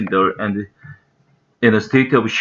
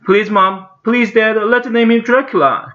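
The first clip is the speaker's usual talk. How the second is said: put-on high-pitched begging kid; pleading boy's cry; 11 semitones higher than usual. A narrator's reading. Toby begging mom and dad for a name, high pleading cry. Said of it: "cried Toby".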